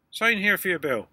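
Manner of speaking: loud, high gruff desk sergeant; weary gruff desk sergeant. Retold or imitated. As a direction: bark it like a bored sergeant. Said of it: "said the desk sergeant".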